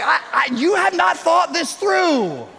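very high, slow shout; dad shouting at his daughter about the brake; panicked yell. Retold or imitated. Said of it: "Kind of the same conversation".

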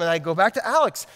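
ordinary delivery

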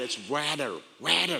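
low, corrective American "wadder"; American accent on water, flat a and tapped t. Retold or imitated. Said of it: "if you're American, it's water".

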